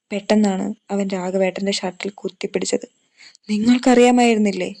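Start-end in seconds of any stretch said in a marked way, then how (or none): 3.5-4.7 loud and higher, voice trembling; shaking, angry cry. A narrator's reading. Devan grabs Raghavan's shirt, shaking with rage. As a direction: hurl the accusation, shaken. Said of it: "അവന്റെ ശബ്ദം വിറയ്ക്കുന്നുണ്ടായിരുന്നു".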